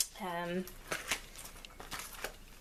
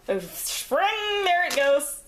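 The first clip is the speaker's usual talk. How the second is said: surprised; 8 semitones higher, slower than usual. Real, not acted. Spring flies off mid-craft.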